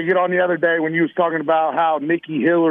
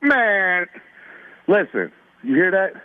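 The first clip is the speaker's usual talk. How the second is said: normal talking voice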